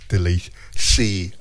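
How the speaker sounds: Delicia drawn out slowly as teh-LEE-see; corrected pronunciation. Shown as a demonstration. Host practising the corrected name Delicia, slow. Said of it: "she said it's Delicia".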